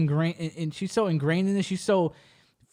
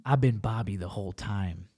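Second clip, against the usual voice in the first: much lower, much softer grave confession; gloomy confession; 10 semitones lower, 8 dB quieter than usual. Retold or imitated. Simran confessing to her cousin, low and grave. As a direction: break the bad news low and heavy. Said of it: "She has a very gloomy expression".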